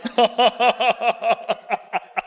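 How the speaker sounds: amused laugh. Real, not acted. Preacher laughing to the congregation at the eager priest. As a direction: laugh at the priest's quick yes.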